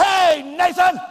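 sharp loud shout of the name, pitched high; yelling at child. Shown as a demonstration. Preacher demonstrating how not to call a child, loud high shout. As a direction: bark the boy's name across the room. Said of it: "I'm not gonna say".

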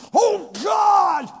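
loud, high shout at God; a puny man throwing a tantrum; irreverent outburst. Retold or imitated. Frustrated believer shouting at God in a temper tantrum. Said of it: "That's irreverent".

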